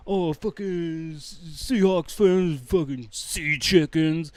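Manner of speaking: sneering fan impression; mocking rival fans. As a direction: drag out the insults like a heckler. Mimics rival fans' jeering.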